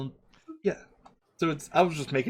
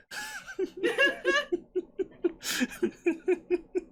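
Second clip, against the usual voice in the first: high laughing fit. Real, not acted. Long laugh at own accent.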